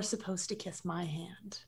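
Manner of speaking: whispered aside. Real, not acted. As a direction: whisper it across the table. Aside between players.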